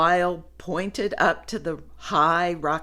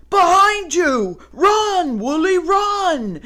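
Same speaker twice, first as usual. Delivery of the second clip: loud high alarm shout; 12 semitones higher, 8 dB louder than usual. Acted. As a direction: yell a frantic warning. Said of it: "shouted Wild".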